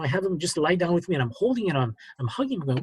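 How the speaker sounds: normal talking voice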